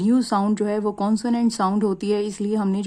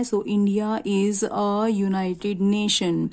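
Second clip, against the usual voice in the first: very slow, deliberate answer sentence; slow classroom reading of the answer; slow model sentence; much slower than usual. Shown as a demonstration. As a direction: spell out the corrected sentence slowly. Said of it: "consonant sound आ रही है।".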